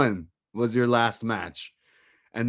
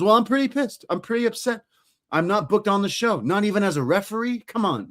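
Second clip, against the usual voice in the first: high-pitched mock grievance; retold upset; 9 semitones higher, faster than usual. Snubbed wrestler complaining to Terry, high mock indignation. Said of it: "he gets mad at Terry".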